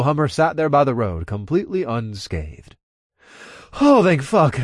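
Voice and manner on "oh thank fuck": relieved. Acted. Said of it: "I let out a relieved sigh".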